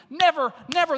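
Never, never hit hard and loud; emphatic never. Shown as a demonstration. Preacher stressing the double negative, emphatic. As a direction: punch each never. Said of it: "That's a double emphatic negative".

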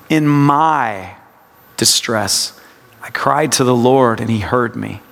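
MY stretched, then a pause; meditative reading, weight on MY; stress on MY. Shown as a demonstration. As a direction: lean on "my" and pause before going on. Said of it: "it's a practice of word emphasis".

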